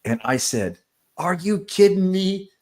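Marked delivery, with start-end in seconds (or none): 1.2-2.5 incredulous coach yelling at the ref, retold; retold complaining. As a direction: replay the sideline complaint, hammed up. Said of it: "I kinda was just complaining".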